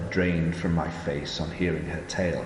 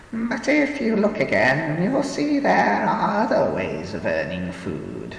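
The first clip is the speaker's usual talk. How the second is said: put-on slow, higher old woman telling; 11 semitones higher, 6 dB louder than usual. A narrator's reading. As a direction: tell the grim tale as an old woman.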